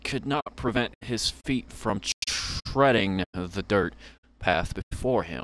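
lightly dramatic storyteller read; a hint of a storybook narrator over the game music; story narration. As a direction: read it like the opening of a tale. Reads the game's narration aloud.

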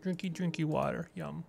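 put-on soft cutesy baby talk. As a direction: coo it like talking to a toddler. Baby talk about water.

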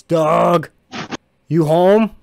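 loud, high call from a distance; voice ringing out. Acted. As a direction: holler it out to someone at home. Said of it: "another voice ring out".

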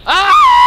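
sudden piercing shriek; real startled scream as something jumps out. Real, not acted. Gamer startled by a monster, piercing scream. Jump scare in horror game.

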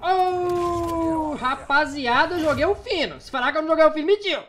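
high, excited boasting; hyped outro. Real, not acted. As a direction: brag to the viewers, fired up. Hyped outro after the match.

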